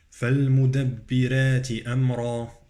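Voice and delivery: recited "فالمدبرات" with thin r, "أمرا" with dark r; light ra, heavy ra. Shown as a demonstration. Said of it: "الراء في كلمة المدبرات مرققة".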